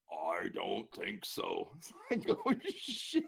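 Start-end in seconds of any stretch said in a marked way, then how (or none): 0.1-1.7 low, very slow struggling answer; gravelly patient in trouble; deep growly voice. Retold or imitated. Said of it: "deep growly voice". 2.5-3.2 high-pitched mock panic; comic high-voiced dismay; retold alarm. Retold alarm at patient not breathing.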